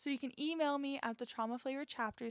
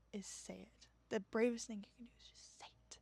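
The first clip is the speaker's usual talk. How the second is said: whisper; 6 dB quieter than usual. Shown as a demonstration. Host whispers encouragement to her listeners. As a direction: whisper it, barely voiced.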